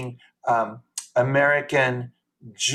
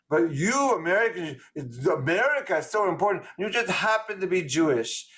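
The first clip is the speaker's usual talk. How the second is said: put-on higher-pitched, emphatic French accent; 4 semitones higher than usual. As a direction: lay on a thick French accent, lecturing the Americans. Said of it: "in this French accent".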